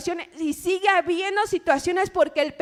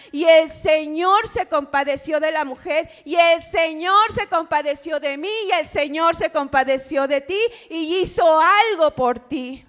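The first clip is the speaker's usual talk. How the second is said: preaching; 4 dB louder than usual. Acted. Predica con fuerza.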